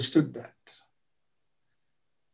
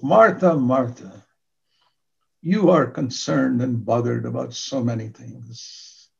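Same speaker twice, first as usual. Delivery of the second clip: gentle Jesus; 3 semitones lower, slower than usual. Acted. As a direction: correct her gently like a loving master. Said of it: "the tone of voice with which Jesus spoke".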